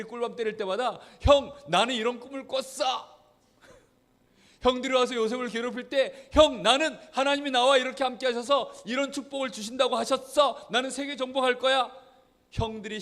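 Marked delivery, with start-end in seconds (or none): 1.2-3.1 high, loud, eager announcement; boasting young Joseph. Acted. 6.3-11.9 high, loud, eager announcement; boasting young Joseph. Acted. Joseph declaring to brothers.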